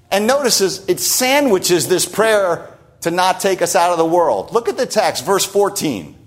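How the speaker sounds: normal talking voice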